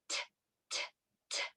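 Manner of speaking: crisp voiceless "t", evenly spaced; ticking letter sound. Shown as a demonstration. Teacher asking which letter this is, ticking "t" sounds. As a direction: tick "t" like a clock. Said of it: "like a ticking clock".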